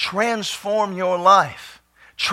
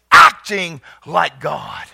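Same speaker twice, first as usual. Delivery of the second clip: ordinary talk, nothing marked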